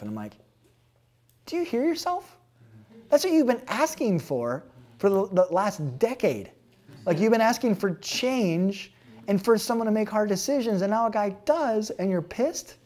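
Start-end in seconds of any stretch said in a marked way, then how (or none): 1.5-12.8 higher and louder, disbelieving scold; incredulous retort. Retold or imitated. Pastor scolding young clergy about wanting change, incredulous and loud. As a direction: hammer it home with disbelief.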